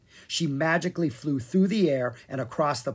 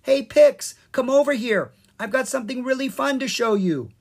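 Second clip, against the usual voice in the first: high, loud call across the playground; loud, coaxing call; yelled; 11 semitones higher, 7 dB louder than usual. Acted. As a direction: shout to lure Pix over. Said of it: "Sprite yelled at Pix".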